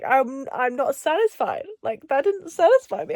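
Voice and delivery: ordinary delivery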